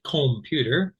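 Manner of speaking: "computer" with a full long O in the first syllable; mispronounced "computer", first vowel as in "home". Shown as a demonstration. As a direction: say "computer" as "kohm-puter". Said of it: "I didn't say computer".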